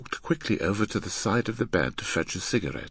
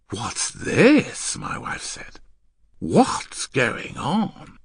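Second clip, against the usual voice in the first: higher, drawn-out astonished question; startled wife; 6 semitones higher, 3 dB louder, much slower than usual. Acted. Wife overhearing the neighbours on the radio, astonished. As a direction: ask in raised, stretched amazement.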